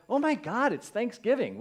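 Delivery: high, loud burst of holiday excitement; hammed-up thrill, pitched high and loud; mock excitement. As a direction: exclaim it with exaggerated delight. Mock joyful waking on holiday.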